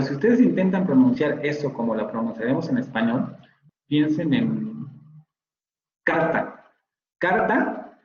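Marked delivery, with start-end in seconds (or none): 6.0-8.0 carta with a clear Spanish tapped R. Shown as a demonstration. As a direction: tap the R in carta firmly. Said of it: "ahí sí tenemos una vibrante". (carta con vibrante española)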